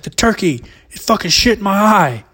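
high, loud outraged complaint; upset dad. Retold or imitated. Dad tells son what the turkey did, indignant mock yell. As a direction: wail it like the victim of a cruel prank. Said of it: "He's like".

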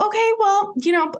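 high, chirpy put-on brightness; high fake cheerful. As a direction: chirp it like you're playing nice. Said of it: "And I go".

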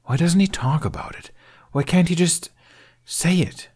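high, strained hurt questions; upset pleading. Acted. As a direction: let the hurt burst out. Boy's pent-up hurt bursting out.

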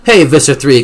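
gloating callout; crowing. A narrator's reading. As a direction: crow it at a beaten enemy. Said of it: "Cassie crowed".

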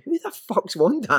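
high, slow puzzled question; a baffled star wondering aloud. Retold or imitated. Star players puzzled at the coach's nickname, high and baffled. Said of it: "they're thinking".

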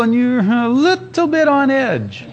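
high, slow, strained "little bit on edge"; mock-tense drawn-out delivery; comically on edge. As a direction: stretch "little" tight and high. Comic picture of tense faster.

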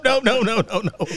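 normal talking voice

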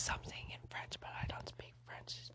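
whispered, breathy aside to the listener. Real, not acted. Reader whispers an aside to the listener about French. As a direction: drop to a whisper to admit it.

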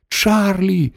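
high-pitched urgent call; crying out. A narrator's reading. Wonka calling Charlie for help, high urgent cry. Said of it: "крикнув містер Вонка".

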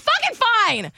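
high-pitched mock giving in; retold exasperated surrender. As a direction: give in with exasperation. Mother gives in, retold.

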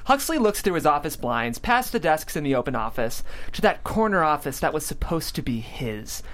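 ordinary talk, nothing marked

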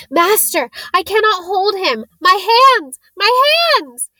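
high, loud cries of pain; panicked cry. Acted. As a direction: plead in agony. Quirrell burning, crying out.

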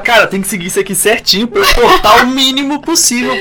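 half-laughing bossy demand; insistent instruction. Retold or imitated. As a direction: lay down the law with a laugh. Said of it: "eu falava".

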